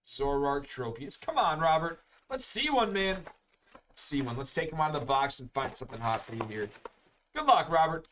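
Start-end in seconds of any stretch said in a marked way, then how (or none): 1.2-3.2 higher-pitched, eager urging; root for a good pull, pitch jumping up; cheering on. Real, not acted. Cheering on a pack opening. 7.3-8.0 louder, higher good-luck call; rooting for someone. Real, not acted. Wishing luck before pack opening.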